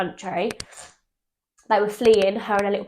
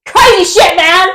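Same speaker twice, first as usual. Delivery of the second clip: excited; 9 semitones higher, 14 dB louder than usual. Real, not acted. Exclaiming about story.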